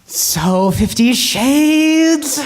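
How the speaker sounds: skeptical drawn-out question. Acted.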